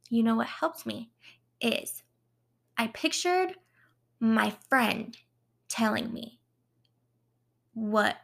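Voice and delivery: ordinary talk, nothing marked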